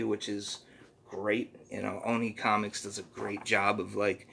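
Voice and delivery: ordinary delivery, nothing marked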